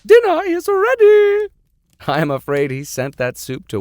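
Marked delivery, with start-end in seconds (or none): 0.0-1.5 high, loud, drawn-out call; housekeeper calling out. Acted. Housekeeper Martha calls the professor to dinner, high and loud. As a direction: sing it out to the whole house. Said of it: "Martha opened the study door, saying".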